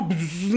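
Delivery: imitated buzz of a passing jeep; buzzing whoosh. Showing friends the jeep speeding past, buzzing noise. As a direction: buzz like a vehicle zipping by.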